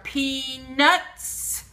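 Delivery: "peanuts" slow, split as pea, nuts; sounding out. Shown as a demonstration. Teacher showing the n in peanuts, "peanuts" slowly split. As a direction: stretch "peanuts" into two held beats. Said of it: "Where's the na in peanuts?".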